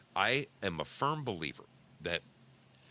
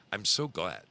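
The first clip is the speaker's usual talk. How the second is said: usual speaking voice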